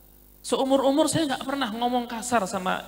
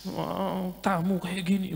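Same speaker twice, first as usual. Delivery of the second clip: quiet, sulky grumble under the breath; inner grumble; 3 semitones lower, 8 dB quieter, slower than usual. Retold or imitated. Hungry boy grumbling inwardly at the guests, quiet and sulky. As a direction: grumble to yourself so nobody hears. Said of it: "Gruntel dalam hati".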